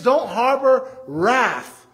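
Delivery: slow, each word stressed; slow emphatic command. Acted. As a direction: press each word firmly and slowly.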